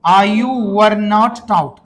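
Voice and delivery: very slow, word by word; slow model sentence. Shown as a demonstration. Teacher to his class, dictating the answer very slowly. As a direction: drag each word out for repeating. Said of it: "कहाँ रहते मेरे भाई?".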